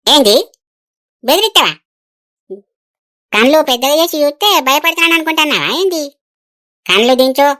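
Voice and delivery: loud, indignant comeback in a quarrel; defiant challenge. Acted. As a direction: challenge him sharply, refusing to be scared. Acted quarrel, wife defies husband's threat. (భయపడకుండా కోపంగా ఎదురు తిరిగిన స్వరం)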